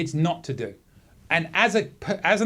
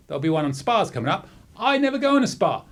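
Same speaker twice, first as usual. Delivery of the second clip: ordinary talk, nothing marked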